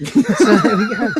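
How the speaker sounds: words squeezed out through high laughter; can barely get it out for laughing. Real, not acted. Cracking up while wrapping up the story, laughing.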